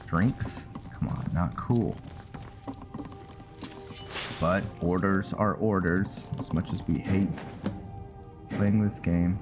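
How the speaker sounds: ordinary delivery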